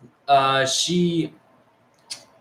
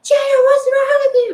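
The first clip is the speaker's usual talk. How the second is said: very high, loud mock shriek; hammed-up high-pitched wail; imitated screaming; 5 dB louder, slower than usual. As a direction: screech it slowly, mimicking an outraged girl. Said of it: "she starts screaming".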